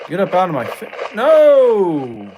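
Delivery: loud high anguished no; despairing shout. Real, not acted. Gamer shouting as his character is caught. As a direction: cry out as it all goes wrong. Character dies, player reacts.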